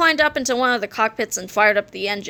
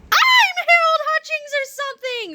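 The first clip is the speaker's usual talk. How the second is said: high-pitched, overjoyed squeal; screamed. A narrator's reading. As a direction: scream it like someone going crazy with joy. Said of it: "screamed Harold as he jumped up and down".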